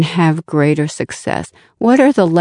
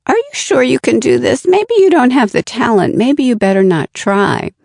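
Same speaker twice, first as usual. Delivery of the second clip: higher, quicker nagging doubter; fixed mindset voice; 7 semitones higher, faster than usual. Acted. Inner critic discouraging someone from trying, high quick doubting. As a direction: voice the doubts in a raised, hurried pitch. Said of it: "the fixed mindset voice says something like".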